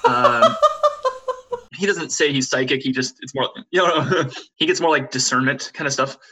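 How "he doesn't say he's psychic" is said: plain reading voice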